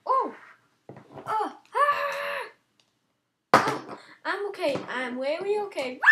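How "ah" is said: sudden high shouted Ah; one sharp high cry; high yell. Real, not acted. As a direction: yell out in shock. Game mishap, yelling.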